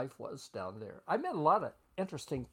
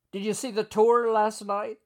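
higher, loud question across the office; yelling across the room; 7 semitones higher, 11 dB louder than usual. Retold or imitated. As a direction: call it out over the heads in between. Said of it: "We were yelling this back and forth".

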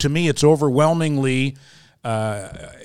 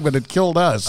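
ordinary talk

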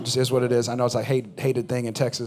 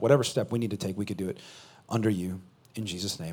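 soft, low, reverent prayer; quiet prayer; 5 semitones lower, 5 dB quieter than usual. Acted. Pastor leads the church in prayer, soft and reverent. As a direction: pray softly before the sermon.

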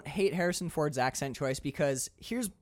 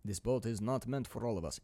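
put-on Russian accent line; 5 semitones lower, 7 dB quieter than usual. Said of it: "right up top, I will acknowledge".

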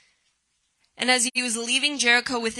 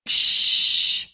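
one long, forceful shush; loud shush; 8 dB louder than usual. Acted. Crowd tells the beggar no one cares, loud shush. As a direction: hush him with a drawn-out hiss. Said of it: "He's going".

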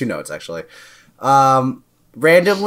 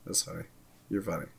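hushed reassurance; 6 semitones lower, 21 dB quieter than usual. Real, not acted. Soft reassurance to Allison.

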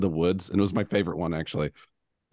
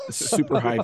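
high demo; 9 semitones higher than usual. Shown as a demonstration. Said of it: "it was, like".

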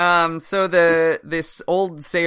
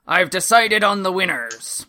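put-on old sailor voice; 5 semitones higher, 6 dB louder than usual. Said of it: "he decides".

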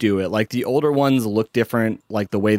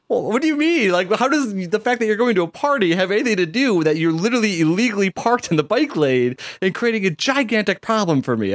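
indignant disbelief; 8 semitones higher than usual. Retold or imitated. Indignant retort to illegal parker.

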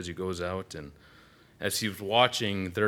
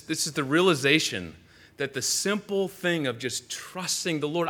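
ordinary talk

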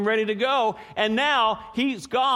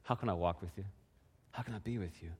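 gentle sincere offer; 10 semitones lower, 12 dB quieter, faster than usual. Retold or imitated. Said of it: "But coming and saying".

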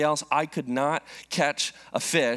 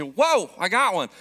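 excited little sister; 5 semitones higher, 5 dB louder than usual. Retold or imitated. Little sister reeling in a fish.

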